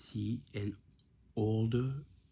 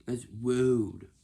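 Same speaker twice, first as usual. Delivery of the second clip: loud; 3 semitones higher, 10 dB louder than usual. Shown as a demonstration.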